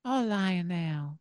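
soft, slow, drawn-out mock pop-star voice. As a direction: coo the name softly and slowly. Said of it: "He wouldn't stop calling me [name]".

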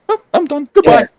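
high, breezy mock goodbye; lightly mocking a wife walking out; mock breezy quit. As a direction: toss it off in a high sing-song. Mocking his wife quitting casually.

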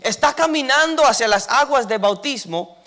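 high, loud preaching climax, building fervor; preaching at full force. Acted. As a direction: lift the congregation with a loud, high sermon push.

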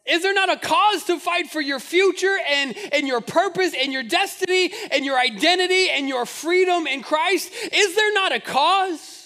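loud, rising, driving repeated question; fiery preaching. Acted. Pastor rallies the church to fight for faith, preaching hard. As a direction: build it like a rallying cry.

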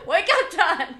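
loud laughing yell; shouting. Acted. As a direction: yell it out to wake someone. Playful wake-up yell at co-host.

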